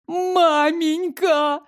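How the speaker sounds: high, drawn-out whining wail; fake crying wail. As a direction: wail it like a crybaby. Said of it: "Из глаз тощей покатились самые настоящие слёзы".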